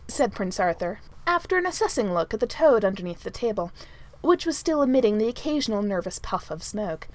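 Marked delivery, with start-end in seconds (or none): none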